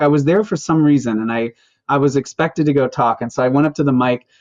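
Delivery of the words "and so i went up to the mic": usual speaking voice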